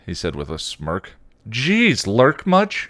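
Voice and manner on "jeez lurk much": put-on high, loud indignant teenage girl; snarky kid sister, pitched well up; demanding teen. A narrator's reading. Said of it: "she demanded".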